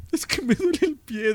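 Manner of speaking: high, drawn-out whine of pain; mock crying. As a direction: whimper it slowly like a hurt drunk. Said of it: "iba llorando".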